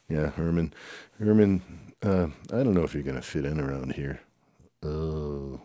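normal talking voice

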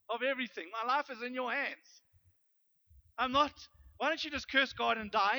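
high, loud, impassioned pulpit voice; full-force preaching. Acted. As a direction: lift the congregation up, pressing hard.

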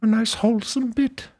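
gentle high Pooh bear; Winnie the Pooh voice. Pretend. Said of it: "Winnie Rourke".

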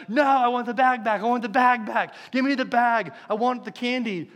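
high, loud mock child tantrum; Austin freaking out. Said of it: "Austin starts freaking out".

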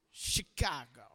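low, soft Midwest-accented place name. Shown as a demonstration. Visiting speaker tells students where he lives, Midwest accent. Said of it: "Minnesota Midwest accent say".

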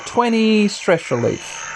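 ordinary delivery

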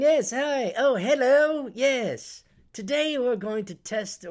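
high, hammed-up British presenter; exaggerated British video host; mock British accent. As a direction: greet viewers as a chipper fake Brit. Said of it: "guy with a British accent".

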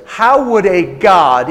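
loud, slow, indignant question; imitating an angry woman questioning God; bitter challenge. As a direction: challenge them forcefully and slowly. Acted bitter challenge about God.

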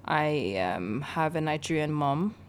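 ordinary delivery

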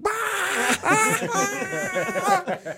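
very high, loud cackling wail; hammed-up high shriek of laughter; acted howling laugh. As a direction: re-enact helpless howling from above. Imitates own laughing from the stairs.

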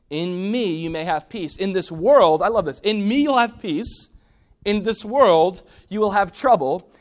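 loud, rising preacher's cry; full-voiced sermon delivery; preaching at full force. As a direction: proclaim it to the room. Preaching Jesus' words.

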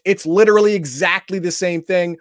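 ordinary talk, nothing marked

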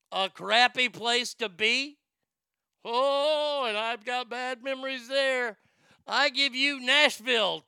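high, loud ringside intro; fight announcer. Acted.

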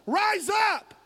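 high, loud preacher's shout; shouted command. Real, not acted. Preacher commanding the church to rise up, full shout. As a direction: belt it out as a rallying cry.

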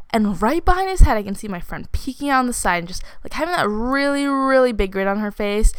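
normal talking voice

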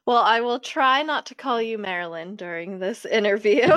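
ordinary talk

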